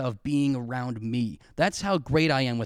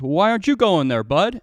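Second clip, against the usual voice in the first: imitating dad, louder; 4 semitones higher, 7 dB louder than usual. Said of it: "My dad goes".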